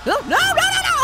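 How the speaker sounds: high, loud panicked pleading; begging not to be found. Real, not acted. Player panicking as the neighbor finds him.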